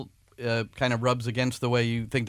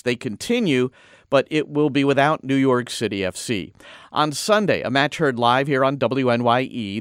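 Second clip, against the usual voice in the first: brisk radio sportscast voice; sports news read; 5 dB louder than usual. Acted.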